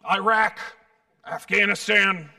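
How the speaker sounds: loud imitation of a gravelly good old boy; mock gruff, gravelly guesswork. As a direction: bellow it like a hearty, gravel-throated stranger. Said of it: "in a gravelly voice".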